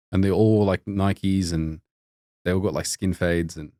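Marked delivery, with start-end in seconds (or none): none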